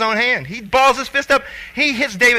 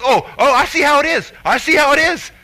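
sulky threat; 7 semitones higher, 5 dB louder than usual. Retold or imitated. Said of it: "He goes".

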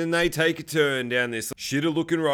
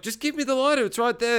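much higher-pitched, imitated insistence; mock exasperated demand; 8 semitones higher than usual. Man asking woman for the lighter, high mock exasperation. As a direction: push the request up high, lightly acted.

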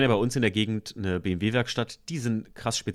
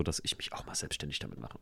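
whispering; 7 semitones lower, 13 dB quieter than usual. Real, not acted. Quiet aside about going self-employed.

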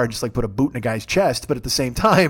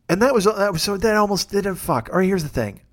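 ordinary talk

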